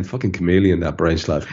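ordinary delivery

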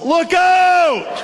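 high shouted look out; sudden high shout of look out; yelled warning. Retold or imitated. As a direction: yell a warning from behind. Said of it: "somebody yelled".

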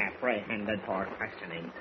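low, slow, disbelieving repeat; incredulous echo. Acted. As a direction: repeat it slowly, softly, in disbelief. Incredulous repeat of official's words.

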